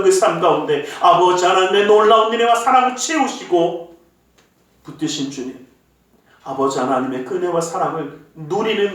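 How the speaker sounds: earnest, measured prayer voice; closing prayer. Acted. Pastor leads the congregation in a closing prayer, earnest. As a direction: pray over the people sincerely.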